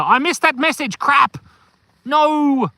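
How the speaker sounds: loud, high-pitched cry of dismay; loud "oh no" exclamation; dismayed outburst. Real, not acted. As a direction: blurt it out in real frustration, pitch jumping up. Sudden dismay at missed chat message.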